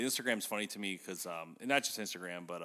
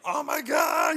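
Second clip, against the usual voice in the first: very high, loud shriek of alarm; mock panicked yell; 11 dB louder, slower than usual. Host retelling a character attacked by bees, mock panicked yell. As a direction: yell it out in hammed-up terror.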